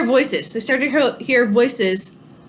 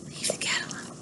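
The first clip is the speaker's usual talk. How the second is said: hushed whisper, no voice at all; 16 dB quieter than usual. Real, not acted. Teacher whispering a warning to the dog mid-lesson.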